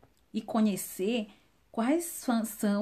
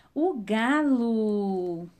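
drawn-out answer reveal; slow reveal; much slower than usual. Shown as a demonstration. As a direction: stretch it out like a big reveal. (resposta revelada bem devagar)

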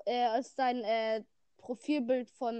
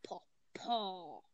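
Minecraft villager sound; 4 semitones lower, 3 dB quieter, slower than usual. Retold or imitated. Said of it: "Villager Sound nachmachen".

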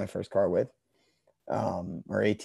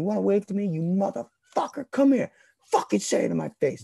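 much higher, heated mock taunt; mock angry challenge; 11 semitones higher than usual. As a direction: dare the player to come say it. Retold challenge to waving players.

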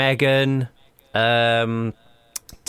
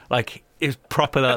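normal talking voice

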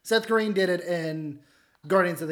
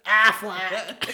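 Aflac duck. Retold or imitated. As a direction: squawk it like the insurance duck. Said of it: "same duck who does Aflac commercials".

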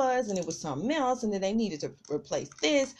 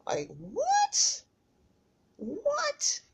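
very high squeaked what, what; squeaky disbelief; much slower than usual. Real, not acted. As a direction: shoot way up in stunned disbelief. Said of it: "disbelief at being sent home".

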